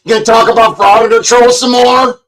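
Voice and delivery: high, loud whiny mock voice; high, loud mock of the chat hecklers; mocking people. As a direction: mimic the hecklers in a squeaky whine. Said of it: "I could ask Denver Metro Audits".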